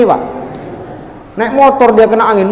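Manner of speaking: ordinary delivery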